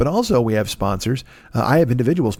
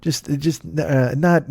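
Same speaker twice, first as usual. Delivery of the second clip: ordinary delivery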